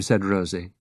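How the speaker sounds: usual speaking voice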